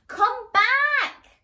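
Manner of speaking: high, loud call after her; princes calling out. Acted. Princes call the princess back, loud high call. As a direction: call it across the garden. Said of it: "called Prince More and Prince Less".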